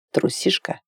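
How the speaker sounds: soft whisper into an ear; whispered taunt. A narrator's reading. Imagined lover leans to her ear, whispered teasing. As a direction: breathe the word without voice.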